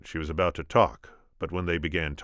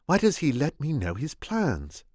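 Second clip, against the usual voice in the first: Buttercup; 11 semitones higher, 3 dB louder than usual. Acted.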